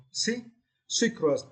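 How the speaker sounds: high, isolated "See", then "Secret" stressed at the front; stress on first syllable. Shown as a demonstration. Teacher modelling the next word, first syllable of "Secret" stressed. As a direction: hit the SE in "Secret". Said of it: "you need to stress the first syllable".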